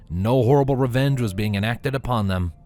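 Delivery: usual speaking voice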